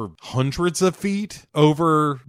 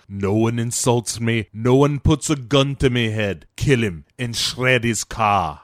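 put-on Scottish crime-boss accent, giving orders; Calitri; 3 dB louder than usual. Calitri orders Cage killed, Scottish accent. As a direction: lay down the law in Scottish, slowing at the end. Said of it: "why he has a Scottish accent".

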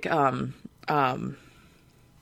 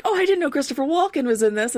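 surprised; 9 semitones higher, faster than usual. Retold or imitated. Quotes own surprised reaction in cinema.

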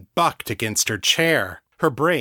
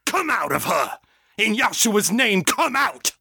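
rising, very high shouting command; climactic command; 10 semitones higher than usual. A narrator's reading. John commanding the demon out, shouting. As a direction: build to a full shout.

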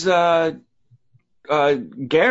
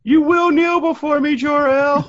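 commanding villain; 10 semitones higher, 4 dB louder than usual. Retold or imitated. Villain quote from Superman.

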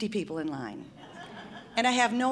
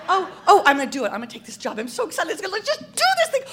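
giddy excitement, leaping high and loud; thrilled outburst; 9 semitones higher, 9 dB louder, much faster than usual. Retold or imitated. Wife committing to her dream job, thrilled outburst. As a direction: burst out as if unable to contain it.

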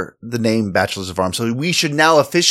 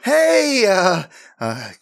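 smug announcement; 9 semitones higher, much slower than usual. Acted. Announcing as new landlords.

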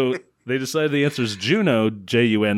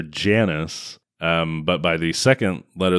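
ordinary delivery

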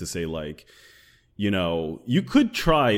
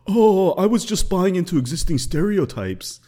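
high, louder mock-innocent voice; mocking excuse-maker; 11 semitones higher, 5 dB louder than usual. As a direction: imitate his feeble excuse in a raised, scornful voice. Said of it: "gave an excuse where he was like".

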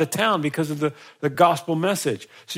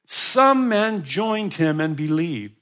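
slow scripture reading; 3 semitones higher, 3 dB louder, much slower than usual. Acted.

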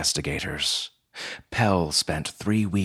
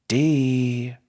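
higher, drawn-out call; 7 semitones higher, 4 dB louder than usual. A narrator's reading. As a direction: call a name across the room, not a full shout. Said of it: "Riga called out".

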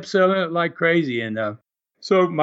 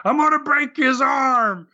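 mock heel yell; 9 semitones higher than usual. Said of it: "he screamed at her".

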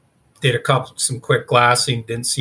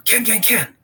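urgent high-pitched mock yell, held back in volume; imitated yelling; 10 semitones higher, 4 dB quieter than usual. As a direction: call the name like a partner raising the alarm. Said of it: "Jeff's yelling at me".

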